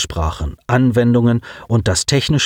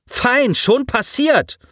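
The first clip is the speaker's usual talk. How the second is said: much higher, slow contempt; spat out; 14 semitones higher, slower than usual. A narrator's reading. Girl spits contempt at Alex, slow and high. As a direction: spit the words out. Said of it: "spie sie förmlich aus".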